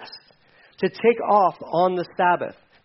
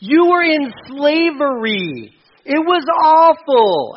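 loud, high, very drawn-out disbelief; exasperated outburst; 9 semitones higher, 6 dB louder, much slower than usual. Acted. Preacher scolding Israelites nostalgic for slavery, exasperated. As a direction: stretch the words out in exasperation.

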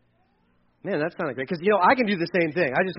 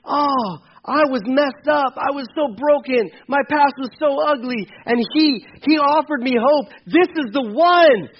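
hopeful; 6 semitones higher, 5 dB louder than usual. Retold or imitated.

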